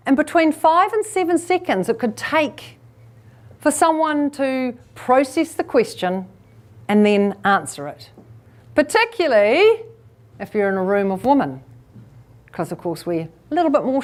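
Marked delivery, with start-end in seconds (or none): none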